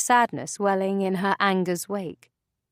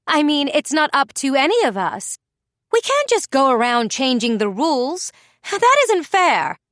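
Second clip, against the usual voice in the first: high, loud annoyed outburst; annoyed snap; 6 semitones higher, 5 dB louder than usual. A narrator's reading. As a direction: blurt it out, irritated. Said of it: "visibly annoyed".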